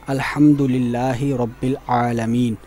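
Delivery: slow melodic tajweed recitation; Quranic recitation. Shown as a demonstration. Teacher reciting the verse slowly for the class.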